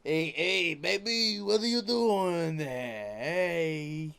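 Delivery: drawn-out, hammed-up Italian-American call; mock Italian-American street voice, slow and sing-song; Italian-American accent. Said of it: "my stupid Italian accent".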